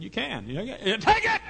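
building to a shout. Retold or imitated. Preacher urging the boy to swallow, building to a shout. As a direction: push the boy on.

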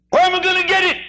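high, loud, startled cry; alarmed question. Acted. Sinner asking Doctor Law about the transplant, alarmed. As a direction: blurt it out in alarm.

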